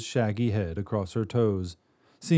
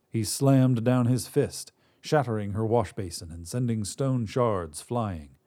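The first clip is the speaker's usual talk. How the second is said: ordinary delivery, nothing marked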